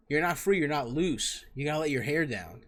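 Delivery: normal talking voice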